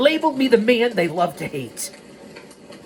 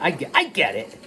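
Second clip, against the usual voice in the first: ordinary delivery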